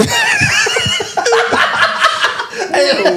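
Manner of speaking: shrieking laugh; high burst of laughter. Real, not acted. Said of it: "Weird mix-up".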